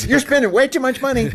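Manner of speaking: high put-on voice, scolding in jest; mock nagging husband. Host teasing the husband about budget talk, mock scolding voice. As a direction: play the worried husband for laughs.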